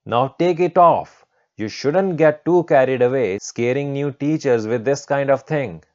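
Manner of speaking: bossy teacher giving an order; scolding teacher. Acted. Teacher orders student to remove what she thinks is a mask, scolding.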